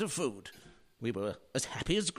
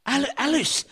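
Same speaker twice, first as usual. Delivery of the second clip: loud, higher cry of her name; 8 semitones higher, 7 dB louder than usual. Acted. Husband crying out to his wife, loud and high. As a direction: call out to her in alarm.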